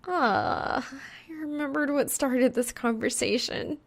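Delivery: a lightly whining lament. Acted. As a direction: moan it like a small tragedy. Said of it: "she laments".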